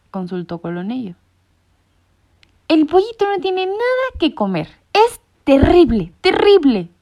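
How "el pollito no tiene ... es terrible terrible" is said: fussy know-it-all insisting. A narrator's reading. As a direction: wring his paws over the poor chick. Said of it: "insistió Sabelotodo". (sabelotodo alarmado que insiste)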